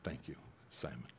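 a gentle barely-voiced thank you; quiet thanks. Jesus imagined thanking Simon.

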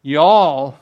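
"Y'all" punched loud and falling; emphatic y'all. Shown as a demonstration. Pastor stresses Jesus's plural you to the church, loud. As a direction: hit "Y'all" hard, it's emphatic. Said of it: "it's in the emphatic sense in Greek".

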